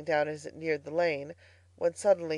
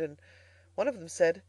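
usual speaking voice

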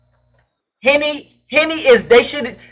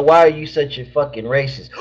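ordinary talk, nothing marked

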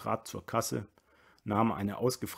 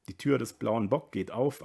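plain reading voice

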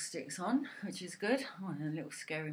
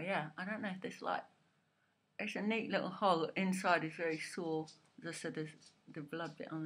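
talking with lip held open; speaking with fingers at mouth; fingers pulling lip down; 3 semitones higher than usual. Real, not acted. Seen 2: fingers hold lower lip open throughout the labelled words.

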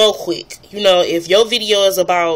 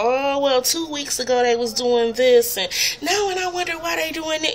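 mock rambling vlogger; 6 semitones higher, 4 dB quieter than usual.

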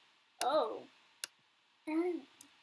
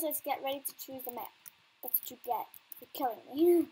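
ordinary talk

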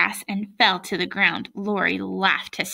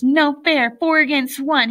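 loud, higher-pitched protest; indignant raised-voice outcry; shouting; 5 semitones higher, 5 dB louder than usual. A narrator's reading. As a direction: shout it as a tearful kid's complaint. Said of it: "he shouted".

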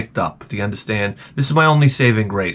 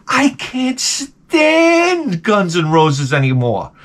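high, agonized drawn-out complaint; exasperated; 4 semitones higher, much slower than usual. Acted. Comic fed up with the radio's favourite band, exasperated. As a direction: stretch it into a slow wail.

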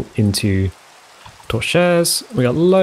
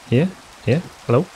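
usual speaking voice

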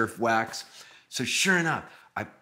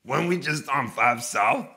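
lightly voiced singer, louder and higher; 4 semitones higher, 5 dB louder than usual. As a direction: question it in a slightly put-on voice. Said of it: "And he goes".

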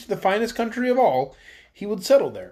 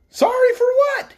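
much higher, louder, slow challenge; demanding; 7 dB louder, slower than usual. A narrator's reading. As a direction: fire it back at the sparrow. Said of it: "he demanded".

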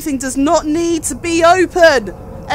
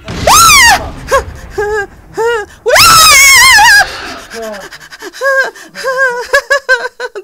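high loud shriek melting into laughter; real panic laughter; scream into hysterical laugh; 11 semitones higher, 8 dB louder than usual. Real, not acted. Player screaming then laughing in shock. After jump scare.

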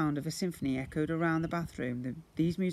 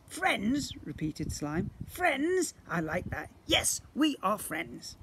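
high creature voice; 6 semitones higher, slower than usual. Acted. Slime delighted.

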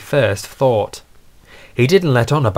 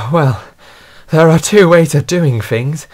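sigh, then puffed-out reply; lightly acted out of breath; panting; 5 semitones higher, 4 dB louder than usual. A narrator's reading. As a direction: speak while catching breath. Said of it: "panted Montague".

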